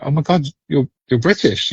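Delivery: lightly put-on American accent. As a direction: mimic a surprised American passenger. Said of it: "the Americans be like".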